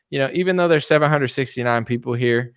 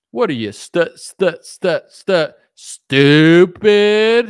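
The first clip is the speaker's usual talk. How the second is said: mock extra-slow talk; 4 semitones higher, 8 dB louder, much slower than usual. Mocking the commenter who says he talks too slow, drawn-out stutter. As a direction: stretch out stupid very slowly, and louder at the end. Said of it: "You talk too slow".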